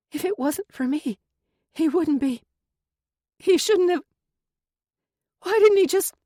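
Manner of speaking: rising into near-sobbing protest; distressed. A narrator's reading. Chelsea breaks down distressed.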